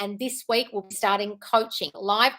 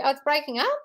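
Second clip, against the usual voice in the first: usual speaking voice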